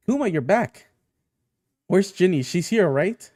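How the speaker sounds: high, hurried-sounding hopeful questions; eager, anxious greeting. Acted. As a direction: greet someone back, anxious for news. Anxious greeting on Kuma's return.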